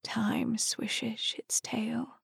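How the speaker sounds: very slow, soft whisper; hushed, lingering whisper on the wind. A narrator's reading. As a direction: whisper it, drawing out each word. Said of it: "and whispered again".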